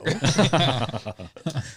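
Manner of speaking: high cackling laugh imitation; imitated cackle. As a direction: cackle like Zipper. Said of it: "That was his laugh".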